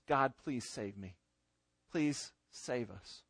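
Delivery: slow, low, soft begging; hushed prayer, unhurried and low; humble, desperate plea. Shown as a demonstration. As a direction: plead slowly and quietly with God. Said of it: "a humble, desperate cry".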